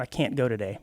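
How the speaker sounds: "can't" ending in a glottal catch, no released T; dropped final T. Shown as a demonstration. Host demonstrating a soft final T to co-host, clipped "can't". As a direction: swallow the T of "can't". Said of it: "you sort of".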